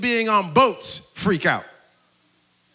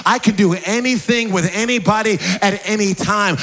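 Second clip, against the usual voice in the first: impassioned preaching; 5 semitones higher, 4 dB louder than usual. Real, not acted. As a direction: preach it with rising fervour, higher and louder towards the end. Preacher builds to full force.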